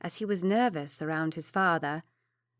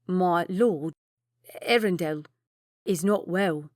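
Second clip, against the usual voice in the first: stammering; 4 dB louder, slower than usual. A narrator's reading. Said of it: "He stammered".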